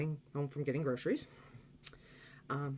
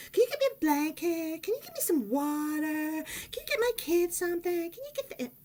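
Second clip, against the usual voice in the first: much higher, mock-needy string of requests; whiny demanding woman; 10 semitones higher than usual. Narrator mimicking the crash woman's nagging requests for things. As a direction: imitate her endless asks.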